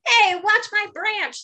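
Bob getting splashed, objecting; Bob protesting. Acted. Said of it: "says Bob".

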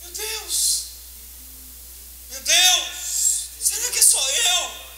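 high, slowed amazed cry; stunned by lightning across the church; astonished awe. Retold or imitated. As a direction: gasp it out in wonder. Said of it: "eu disse".

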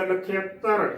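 ਨਖੇਤਰ said na-khi-ak-tar; "nakhiaktar" for ਨਖੇਤਰ; demonstrated pronunciation. Shown as a demonstration. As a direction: glide from i to a after the kh in ਨਖੇਤਰ. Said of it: "ਤੱਤੇ ਤੋਂ ਬਾਅਦ ਹੀ ਉਚਾਰਨ".

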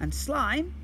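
a hint of a young boy; lightly voiced boy. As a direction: play young Ned, curious. Ned voice.

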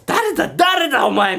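high, loud demanding shout; menacing shout. Retold or imitated. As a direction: bark at the intruder. Ghost girl shouting at him.

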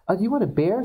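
lightly voiced hostess, pitched high; a hint of a chirpy hostess. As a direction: offer the beer in a high sweet voice. Hostess offers a drink.

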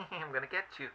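lightly voiced sneaky little menace; a hint of a teasing villain, half laughing; inner saboteur voice. The self-doubt voice taunting the viewer, mock sneaky. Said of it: "that little voice inside of your mind, like".

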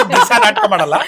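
real laughing protest. Real, not acted. Guest answers the host's teasing about acting daily, laughing. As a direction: laugh off the teasing as you speak.

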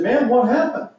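sudden high astonished question; can't believe he's so chipper; amazed campers. Retold or imitated. Campers gaping at fresh Frank, high and astonished.

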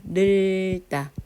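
늘다 with a very long ㄹ; drawn-out pronunciation. Shown as a demonstration. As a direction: hold 늘 slowly before a short 따. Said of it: "리을 비읍은 리을로 발음해요".

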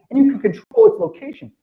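ordinary talk, nothing marked

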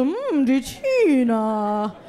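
very slow, drawn-out appreciative hum; slow, lingering, suggestive "die Tina"; leering man. Retold or imitated. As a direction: savour her name like a smitten old man. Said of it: "Der so".

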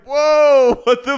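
very high, loud startled outburst; mock shock. Imitating the cult leader finding babies in her house, shocked shout. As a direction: exclaim in exaggerated alarm. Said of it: "and she's like".

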